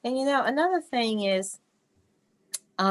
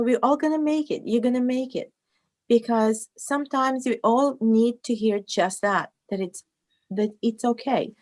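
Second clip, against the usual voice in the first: normal talking voice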